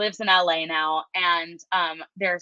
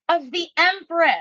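high-pitched, excited punchline; excited reveal; 8 semitones higher, 4 dB louder than usual. Retold or imitated. Friend retelling the necklace gift, excited reveal. Reveals the coincidence in her story.

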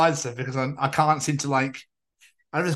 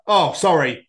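normal talking voice

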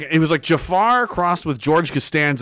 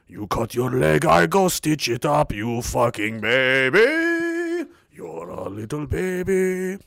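taunting doctor; slower than usual. Retold or imitated. Doctor voiced mocking him.

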